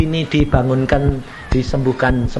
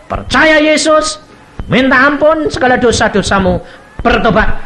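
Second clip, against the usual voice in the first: preaching; 9 semitones higher, 6 dB louder than usual. Acted. Preacher urging the dying mother to repent, loud. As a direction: call out like a revival preacher.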